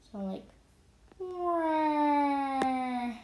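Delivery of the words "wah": high, loud fake baby cry "wah"; mock toddler wail. Boy imitating himself crying at four, mock wail. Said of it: "remember four-year-olds just cry".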